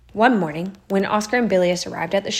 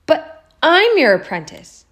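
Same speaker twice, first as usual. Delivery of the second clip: dismayed protest; 8 semitones higher, 7 dB louder, slower than usual. A narrator's reading.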